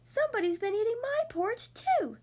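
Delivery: high, indignant mother bear; high-pitched storybook bear cry; Mommy Bear bellow. A narrator's reading. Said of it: "bellowed Mommy Bear".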